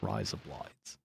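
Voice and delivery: low, soft fake Aussie "razor blades"; Australian-style razor blades. Shown as a demonstration. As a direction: try it quietly and low in an Australian accent. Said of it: "you just replace the A".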